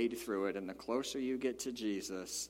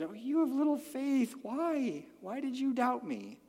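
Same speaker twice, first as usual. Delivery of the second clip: tender mama voice; 13 semitones higher than usual. Retold or imitated. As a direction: comfort a child like a mama. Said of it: "more like a mama holding a kid".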